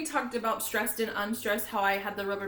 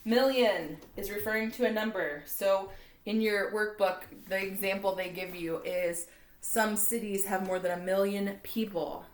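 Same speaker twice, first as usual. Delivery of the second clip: usual speaking voice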